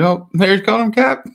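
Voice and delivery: high, loud burst of delight; thrilled fan voice; excited gasp. Retold or imitated. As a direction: squeal it like a fan seeing the moment. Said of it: "I was like".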